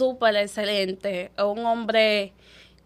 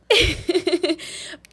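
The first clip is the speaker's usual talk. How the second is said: laughing; 6 semitones higher, 3 dB louder than usual. Real, not acted. Laughing at ironic twist.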